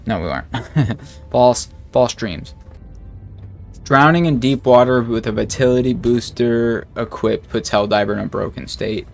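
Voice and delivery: ordinary talk, nothing marked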